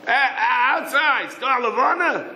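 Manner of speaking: high, slow eager question; excited high asking. Acted. Yosel excitedly asks about moon.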